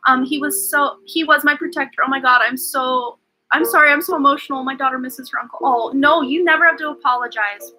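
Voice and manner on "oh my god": usual speaking voice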